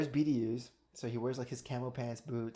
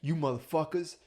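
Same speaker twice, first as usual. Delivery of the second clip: louder, slow gruff coach bark; imitating a gruff trainer; 3 semitones higher, 8 dB louder, slower than usual. Mocking the mustached coach telling people to sleep less, gruff bark. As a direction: bark it like an old-school drill coach. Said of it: "He's like".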